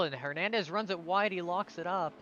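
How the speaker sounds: rising commentator excitement; excited race call. Real, not acted. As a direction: call the mistake as it happens. Live call of a racing mistake.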